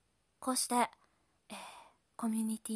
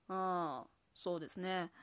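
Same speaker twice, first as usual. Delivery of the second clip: lowered voice playing a young boy; 4 semitones lower, slower than usual. Shown as a demonstration. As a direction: drop the voice a little for a boy. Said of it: "こんな風にちょっと声を低くして". (少年の声)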